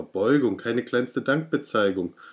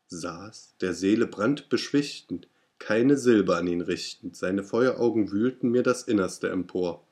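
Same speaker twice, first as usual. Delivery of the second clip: plain reading voice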